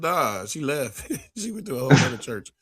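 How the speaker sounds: amused impression of the pastor, cracking up midway; laughing retelling. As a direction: laugh through the pastor's reply. Retold pastor's answer, teller laughing.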